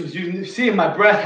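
ordinary delivery

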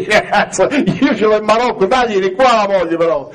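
high, laughing reply; Moroccan man's reply. Retold or imitated. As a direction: laugh through it like the amused bystander. The laughing Moroccan answers back. (il marocchino risponde ridendo con voce acuta)

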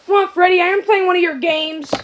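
ordinary delivery

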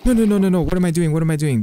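high, loud burst of no's, easing off; panicked. Real, not acted. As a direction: blurt it out in a fluster. Panics while playing.

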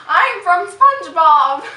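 loud hammed-up pirate, "matey" rising high; playful pirate greeting; mock pirate. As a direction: bellow it like a cartoon pirate. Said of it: "Arg, matey".

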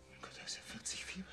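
shocked hushed whisper. Acted. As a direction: breathe it out in alarm. Shocked at fever.

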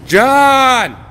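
loud high call across the room. Real, not acted. Calling out to friend John across the room, loud. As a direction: shout his name to find him.

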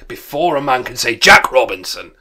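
very loud, jovial cry; Fezziwig. A narrator's reading. Fezziwig hurrying Dick and Ebenezer, loud and jolly. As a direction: boom it out to hurry the lads. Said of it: "cried the old Fezziwig with a sharp clap".

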